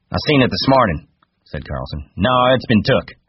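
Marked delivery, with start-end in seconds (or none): 0.1-1.1 higher, indignant rebuttal; insistent. A narrator's reading. Carlson corrects George about the gun, insistent. 2.2-3.2 higher, indignant denial; insistent. A narrator's reading. Carlson insists his gun was stolen, indignant.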